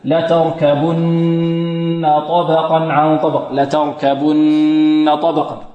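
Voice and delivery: slow recitation, long hum on the nn of لتركبن; غنة كاملة. Shown as a demonstration. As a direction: hold the nasal n of لتركبن fully before طبقا. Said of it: "غن أكمل". (تلاوة بطيئة بغنة كاملة في نون لتركبن)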